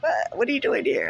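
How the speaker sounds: high startled question; surprised. Retold or imitated. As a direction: caught off guard, high. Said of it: "it's just like".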